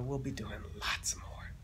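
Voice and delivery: hushed teasing whisper; real playful whisper; soft whisper. Real, not acted. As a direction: whisper it like a secret promise. Signing off to viewers.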